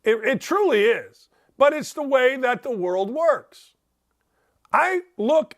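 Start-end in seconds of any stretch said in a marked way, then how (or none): none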